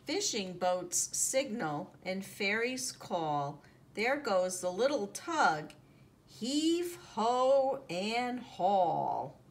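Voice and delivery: plain reading voice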